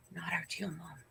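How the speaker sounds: breathy whisper back to mom; whispered retort. Retold or imitated. Whispering a retort to her mother about the house deal. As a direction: hush it out, no voice at all.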